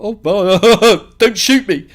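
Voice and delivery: high, loud mock fear breaking into laughter; laughing mock panic. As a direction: plead in hammed-up alarm while laughing. Mock fear retelling his own reaction.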